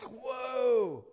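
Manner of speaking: high, loud, drawn-out whoa; hammed-up amazement; awed reaction. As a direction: let out a big astonished whoa.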